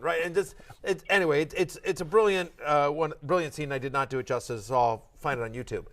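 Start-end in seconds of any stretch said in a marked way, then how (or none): none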